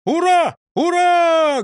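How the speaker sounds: loud high hurrahs; a rousing loud cheer; bellowing cheer. A narrator's reading. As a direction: shout the hurrahs together. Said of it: "гаркнули все".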